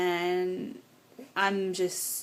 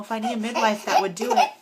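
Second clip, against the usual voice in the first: real crying laugh; 5 semitones higher, 4 dB louder than usual. Real, not acted. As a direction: voice breaking into tearful laughter. Overcome while recalling home birth.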